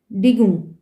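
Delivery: DIGUNG said as its own chunk; separated ending. Shown as a demonstration. Teacher finishing a split word for learners, "Digung" said separately. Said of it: "separately, and then".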